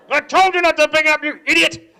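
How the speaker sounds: loud, high exasperated yell; irate dad shouting; dad scolding. Acted. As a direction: bellow at your kid for not listening. Dad scolds child over phone.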